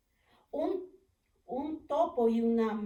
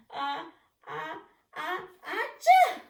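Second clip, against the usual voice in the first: slow building sneeze, loud high burst; big sneeze; 5 semitones higher, 10 dB louder, slower than usual. Acted. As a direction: wind up and sneeze big like a bear. Bear sneezes from pepper.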